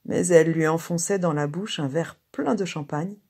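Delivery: plain reading voice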